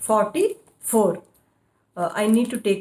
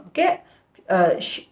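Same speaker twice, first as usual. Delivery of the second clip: ordinary delivery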